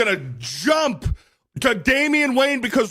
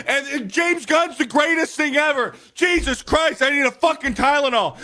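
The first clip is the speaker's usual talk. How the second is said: angry rant; 2 semitones higher than usual. Real, not acted. Cohost ranting to friends about clueless fans, angry. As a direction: vent furiously about the fans. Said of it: "That was a beautiful rant".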